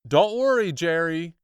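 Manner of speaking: loud, high calling across the room; called out. Acted. Rescuer calling out to trapped boy. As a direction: shout reassurance from afar. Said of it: "he called".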